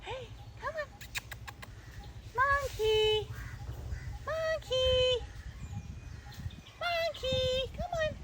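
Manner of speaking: very high sing-song call; lilting call to lure it; calling a monkey. Real, not acted. Luring a monkey with an apple, very high call.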